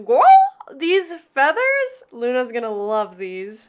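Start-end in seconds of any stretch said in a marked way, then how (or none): 0.0-2.0 excited. Real, not acted. Showing off purchase.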